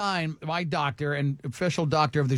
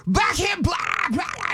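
high, shouted stand-up gibberish; Jim Brewer; 10 semitones higher than usual. Retold or imitated. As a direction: yell a wild mock comedy bit, pitched way up. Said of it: "I remember seeing Jim Brewer".

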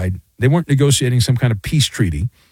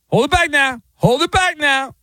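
mock wrestling hype; 11 semitones higher than usual. Mock wrestling announcer restraint.

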